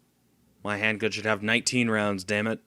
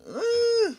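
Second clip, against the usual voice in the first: startled. Real, not acted. Surprised at being caught.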